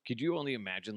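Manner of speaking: usual speaking voice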